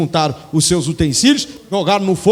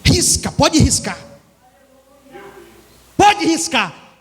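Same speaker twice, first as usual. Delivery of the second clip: higher, slow, pleading insistence on riscar; emphatic offer; 8 semitones higher, slower than usual. Acted. Moses telling God to strike his name from the book, slow and high. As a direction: lay it out slowly, daring God to strike it.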